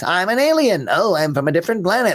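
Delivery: high-pitched stock alien audition voice; typical alien voice. Retold or imitated. Imitating thirty auditioning actors, typical high alien voice. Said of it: "everybody was doing a voice like this".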